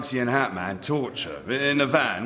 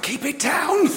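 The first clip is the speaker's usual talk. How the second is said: much higher, louder laughing yelp to hush; urgent outburst; 4 dB louder than usual. Acted. Embarrassed man hushing mention of his posh school, loud yelp. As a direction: blurt it out trying to hush him.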